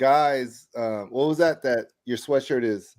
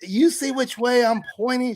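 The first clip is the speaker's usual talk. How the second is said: high, incredulous protest; exasperated pointing out; 9 semitones higher than usual. Retold or imitated. Speaker arguing about the one-way lot, high and incredulous. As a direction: make her see the one-way sign. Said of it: "And I'm like".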